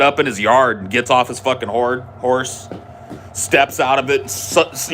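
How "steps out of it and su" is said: plain reading voice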